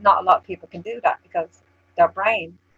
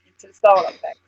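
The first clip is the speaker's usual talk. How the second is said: normal talking voice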